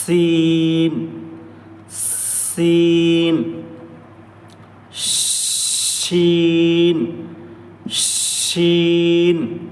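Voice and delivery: سین and شین each held very long and thin; letter demos. Shown as a demonstration. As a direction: model the thin s and sh sounds slowly. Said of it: "سین کی آواز تھوڑی پتلی ہوگی".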